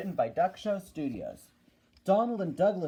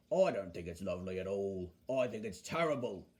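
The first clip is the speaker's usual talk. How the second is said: low, disapproving bus character; Bulgy; 9 semitones lower, 4 dB quieter, faster than usual. Acted. Bulgy running down the small railway, low and disapproving. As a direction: grumble it in a deep voice. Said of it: "said Bulgy".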